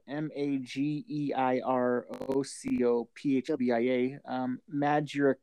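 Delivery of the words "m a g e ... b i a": slow letter-by-letter spelling. Shown as a demonstration. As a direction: spell each letter out slowly. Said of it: "I'll spell it out for you".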